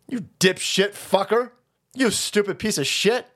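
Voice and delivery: usual speaking voice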